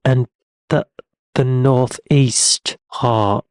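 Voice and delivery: very slow, exaggerated English accent; mock English accent. American listing English places to visit, mock English accent. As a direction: stretch each place name in a hammed-up English accent.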